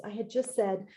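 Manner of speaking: ordinary delivery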